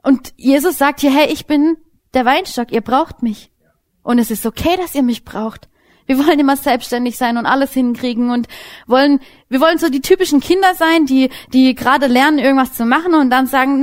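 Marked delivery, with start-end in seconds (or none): none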